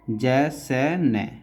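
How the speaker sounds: slow, spaced dictation of each stroke; syllable by syllable. Shown as a demonstration. Teacher to student, spelling each stroke slowly. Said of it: "teacher spelling out shorthand strokes".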